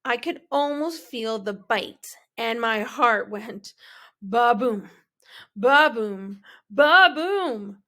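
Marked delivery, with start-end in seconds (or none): 4.2-7.8 loud thumping ba-boom, building; pounding heartbeat. A narrator's reading. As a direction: beat it out like a racing heart.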